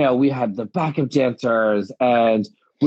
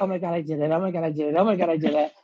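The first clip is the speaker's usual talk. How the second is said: freaking out; 3 semitones higher, 4 dB quieter, faster than usual. Retold or imitated. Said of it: "like, freaking out".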